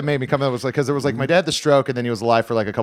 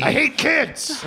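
high mock voice; 13 semitones higher, slower than usual. Jokingly voicing heckler.

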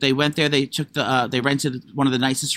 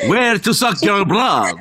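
slow, higher hammed-up vampire voice; Count Dracula; 4 semitones higher, 4 dB louder, slower than usual. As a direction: drag it out like a mock vampire. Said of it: "She sounds like fucking Count Dracula".

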